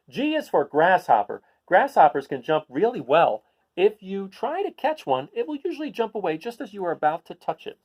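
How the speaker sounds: bright, clear picture-book read-aloud; reading to children. Acted. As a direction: read it out to a class of kids.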